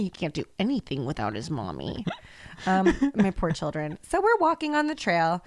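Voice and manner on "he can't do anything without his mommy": low, softer mocking repeat; mock-solemn echo. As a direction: drop the voice low and quieter. Repeats the mommy line much lower.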